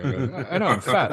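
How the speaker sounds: chuckling self-mockery; laughing. Real, not acted. Teller laughing about being called hungry. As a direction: laugh through the confession.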